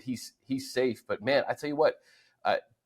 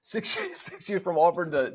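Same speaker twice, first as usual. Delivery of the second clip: laughing while talking; 7 semitones higher than usual. Real, not acted. Laughing at six college years.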